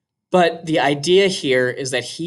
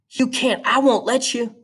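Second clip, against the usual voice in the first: put-on higher young girl, protesting; Scout's voice, higher and urgent; girl's voice; 10 semitones higher than usual. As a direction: play the little sister pushing back. Scout's lines voiced high.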